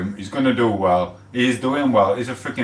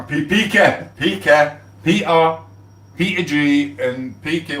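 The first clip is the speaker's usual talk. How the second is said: calling out; 6 semitones higher, slower than usual. Real, not acted. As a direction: call across the room to your mates. Calling mates over to him.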